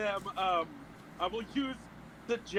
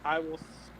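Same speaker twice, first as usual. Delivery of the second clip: ordinary delivery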